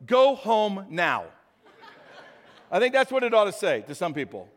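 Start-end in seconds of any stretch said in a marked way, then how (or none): 0.1-1.3 slow GPS command. Retold or imitated. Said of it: "it just goes".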